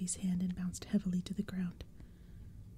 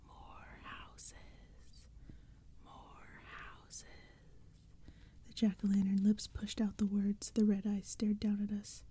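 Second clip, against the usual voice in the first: faint, far-off hissing monster whisper, slowing at the end; eerie growling creature; 6 dB quieter, slower than usual. Acted. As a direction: hiss it like a ghostly demand. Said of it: "one of them growled".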